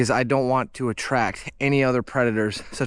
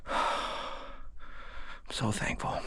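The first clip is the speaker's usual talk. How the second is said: emotional; 3 semitones lower, 11 dB quieter than usual. Real, not acted. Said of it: "I'm gonna get emotional here".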